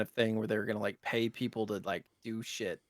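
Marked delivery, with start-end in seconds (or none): none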